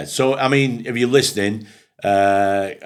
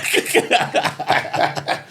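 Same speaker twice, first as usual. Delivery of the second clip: breathy laugh; 10 semitones higher than usual. Real, not acted. Laughing after the story.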